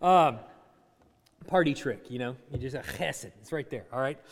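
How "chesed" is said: quiet, low "chesed" with a throaty ch; "chesed" from the back of the throat, softly; throaty Hebrew sound. Shown as a demonstration. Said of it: "like there's something in the back of your throat".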